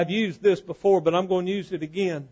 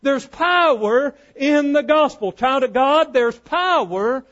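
raised, emphatic pulpit call; fervent preaching; 7 semitones higher, 7 dB louder than usual. Acted. Preacher calls the church to amen, fervent preaching. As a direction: stretch the key words as you proclaim.

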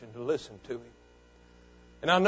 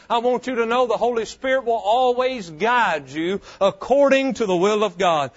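loud, higher, driving sermon voice; preaching at full force; 6 semitones higher, 6 dB louder than usual. Acted. Pastor urging his congregation to submit, full-force preaching. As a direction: preach it out to the whole church.

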